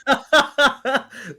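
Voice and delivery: loud, high cackling laugh; burst of laughter. Real, not acted. Laughing at a friend's roast of his story, cackling. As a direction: crack up hard.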